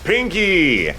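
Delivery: high, loud callout of "Pinky!"; calling out. Real, not acted. Player warns his friend that a Pinky has appeared. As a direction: shout out the enemy's name as it appears.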